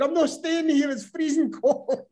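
high, strained, slowed protest; indignant retold protest. Refusing the operator's order to stay put, high indignant whine. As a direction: squeal it like you're frozen and fed up.

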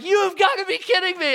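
high-pitched incredulous protest; exasperated disbelief. Retold or imitated. Retelling a stand-off at the turnstile, exasperated disbelief. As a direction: protest as if it's utterly absurd. Retold stand-off over wrist stamp.

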